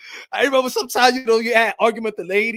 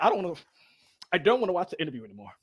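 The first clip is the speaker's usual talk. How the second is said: fast, low, soft sulky grumble; mock sulk, rushed; sulky fan; 4 semitones lower, 7 dB quieter, much faster than usual. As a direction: pout like a fan giving up.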